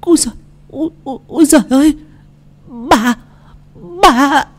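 high stammering gasps; high shocked stammer. A narrator's reading. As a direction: splutter in disbelief. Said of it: "lắp bắp".

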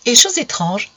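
ordinary delivery, nothing marked

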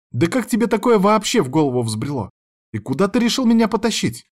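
outraged questions climbing high; indignant outburst. A narrator's reading. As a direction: demand answers in a rising, high voice. Sister angrily questions brother.

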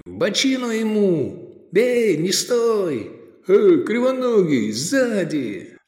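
high fan's shout; yelling from the sidelines. A narrator's reading. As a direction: call it out from the stands. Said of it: "кричали болельщики".

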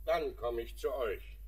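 shouted reply from the house; calling out. Acted. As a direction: call across to the garden. Father calls out from indoors.

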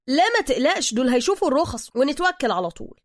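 high, loud brush-off; reassuring driver. Acted. Driver tells Bruce the licence check is nothing, loud reassurance. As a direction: wave the worry away loudly.

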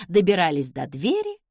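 usual speaking voice

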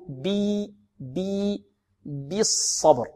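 drawn-out "bee", then slow بالصبر with the same pure i; كسرة خالصة. Shown as a demonstration. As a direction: stretch بي toward a yaa, then say بالصبر. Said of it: "هكذا بي". (إخلاص كسرة الباء في بي وبالصبر)

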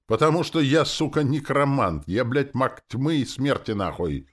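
high, loud furious rant; angry yell. A narrator's reading. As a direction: yell your title in a temper. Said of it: "заорал я". (орать в злости, высоко и громко)

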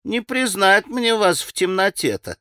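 put-on high old servant woman's voice; puzzled old woman, pitched high; старушка другим голосом. A narrator's reading. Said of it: "совсем другим голосом проговорила старушка". (высокий старушечий голос)

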